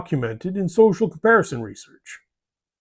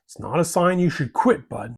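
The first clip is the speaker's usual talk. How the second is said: normal talking voice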